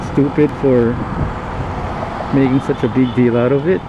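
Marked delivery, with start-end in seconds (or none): none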